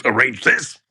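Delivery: high, loud comic whine; mock whiny staffer. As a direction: imitate the weary crew. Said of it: "is going".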